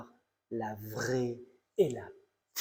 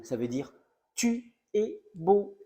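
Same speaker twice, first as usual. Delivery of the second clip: "tu es beau" higher and slow, rising at the end; punchline stress; 7 semitones higher, 3 dB louder, slower than usual. Shown as a demonstration. Landing the punchline for the audience, slow and rising "tu es beau". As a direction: drag out "beau", raised and lifting. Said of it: "Ne veut pas dire Thibault".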